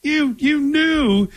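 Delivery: high-pitched, drawn-out mock glee; hammed-up, high excited call; mock excitement. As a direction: pretend to be thrilled at a big win. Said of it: "did you jump up and down".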